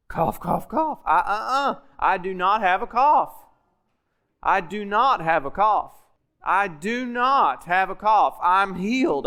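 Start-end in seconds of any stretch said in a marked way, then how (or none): 0.1-1.0 hammed-up coughing; mock coughing. Preacher miming his coughing fit for the congregation. 2.0-8.4 pressing harder on each repeat; insistent declaration. Retold or imitated. Preacher demonstrating his stubborn confession of faith.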